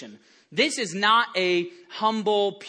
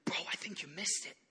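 fast whisper to a friend; whispered aside; 15 dB quieter, faster than usual. Pretend. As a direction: lean over and whisper it. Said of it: "aside whisper to skeptic".

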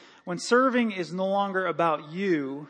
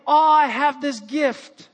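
higher, louder, slow and self-satisfied; mock boasting; 6 semitones higher, 7 dB louder, slower than usual. Pastor mimicking a boastful singer, slow and smug. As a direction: preen over your own talent. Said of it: "She didn't say".

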